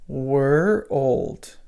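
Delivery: put-on "were old" said nearer together, slight gap; linking "were old" toward world; closer syllables. Shown as a demonstration. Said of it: "Pull them just a little closer".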